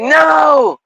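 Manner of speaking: high, shouted melodrama; mock dramatic Peter. Peter demanding love, in a high, hammed-up shout. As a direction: declare it like a pompous hero. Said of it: "And he's like".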